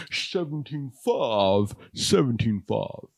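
very slow, put-on suspense-host voice; drawn-out, droll impression. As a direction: quote a price with mock-sinister relish.

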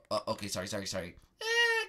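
usual speaking voice

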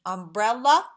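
"umbrella" in three loud beats, rising at the end; "umbrella" divided in three, lifting last; syllable by syllable. Shown as a demonstration. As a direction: clap out each syllable of "umbrella". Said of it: "Three syllables".

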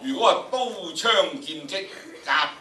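a strong, measured battle declamation; loud battle recital. Shown as a demonstration. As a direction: call the battle words out strongly. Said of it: "大概去到七度到啦".

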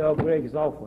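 usual speaking voice

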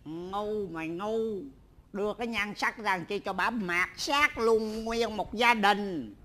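low, soft, slow scolding; muttered scolding. Acted. Father scolding his son quietly, low mutter. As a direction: grumble it under your breath.

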